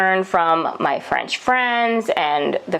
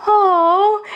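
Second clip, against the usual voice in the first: high, loud mock oh of realization; a sudden high oh; 12 semitones higher, 4 dB louder than usual. Nurses finally understand, with a high surprised oh.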